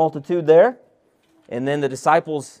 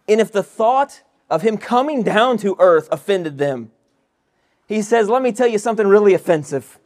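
preaching at full force; 5 semitones higher, 4 dB louder than usual. Acted. Preaching builds to a climax.

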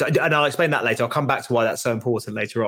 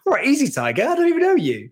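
mock cocky reply; 11 semitones higher than usual. Mocking reply to the Russian.

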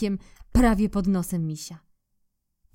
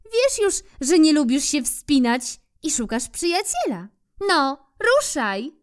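squirrel calling; 8 semitones higher, 4 dB louder than usual. Acted. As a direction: urge the bear onward in a high squirrel voice. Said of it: "zawołała".

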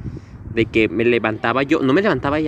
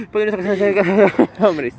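lightly hurried prayer, a bit higher; mock frantic prayer; 6 semitones higher, 4 dB louder, faster than usual. Shown as a demonstration. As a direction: gabble the prayer in mock fear. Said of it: "Yo sí digo". (rezo asustado en broma, más agudo)

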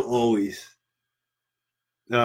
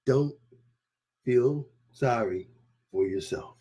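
normal talking voice

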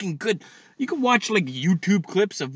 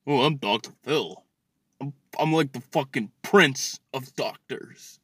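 lightly voiced self-important TV doctor; a touch lower, slowing as he boasts; mock Doctor Phil; 3 semitones lower than usual. Mocking Dr. Phil introducing himself.

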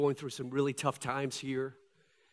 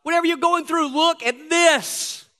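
high, loud call that slows at the end; rally everybody to look; God calling out; 12 semitones higher, 11 dB louder than usual. Acted. God calling to hurting people, high and loud. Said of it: "it's like God is saying".

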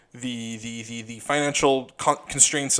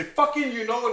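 imitating himself; 9 semitones higher than usual. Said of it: "How many times am I gonna say".

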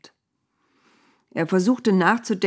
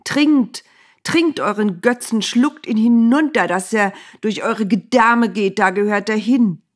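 roaring, much higher and louder, beside himself; out of control with anger; furious shout; 4 semitones higher, 7 dB louder than usual. A narrator's reading. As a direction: hurl the words at them in a rage. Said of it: "und schrie immer noch außer sich".

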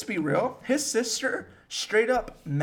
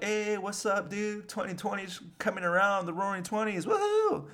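higher but light, playful party greeting; mock carefree cheer; 4 semitones higher, 3 dB quieter than usual. Friends welcoming the roaring twenties, mock carefree cheer. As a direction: lightly play excited, ending on a high whoop.

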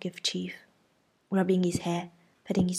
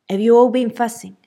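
much louder, stern demand; stern aunt; 4 semitones higher, 12 dB louder than usual. A narrator's reading. Aunt scolding the children for fussing, loud stern voice.

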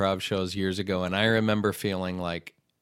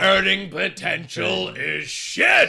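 mock stern dad; 9 semitones higher, 6 dB louder, slower than usual.

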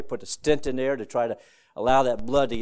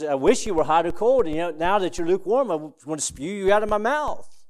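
higher, louder voicing of Jesus rebuking; stern rebuke; 6 semitones higher, 4 dB louder than usual. Preacher voicing Jesus to the lukewarm church, stern rebuke. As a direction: lift the voice and rebuke them.

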